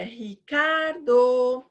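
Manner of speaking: very slow sing-song call; calling out a name. Shown as a demonstration. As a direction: call RICARDO with a long-held CAR. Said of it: "igual você chama a pessoa".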